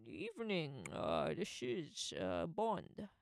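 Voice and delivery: understated Scottish Sean Connery voice; Sean Connery impression. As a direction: ease into a casual Scottish Bond intro like Connery. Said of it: "that's Sean Connery".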